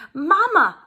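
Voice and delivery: calling out high and loud for Mama; a child calling out loud for her mother. Acted. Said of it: "I called finally".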